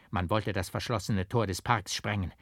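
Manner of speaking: ordinary delivery, nothing marked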